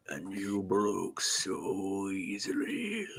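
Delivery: slow, soft, menacing villain voice; drawn-out, creepy monster threat; Vecna voice. Acted. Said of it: "That's a pretty good Vecna".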